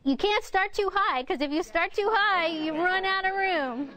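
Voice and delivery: high-pitched, climbing with no room left; playfully high voice, running out of range; starting too high. Shown as a demonstration. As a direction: pitch it way up and end in a laugh.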